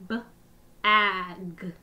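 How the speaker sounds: each sound said separately with a short break; sounds tapped apart. Shown as a demonstration. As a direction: split the word into its sounds, one by one. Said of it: "listen for all the sounds in bag".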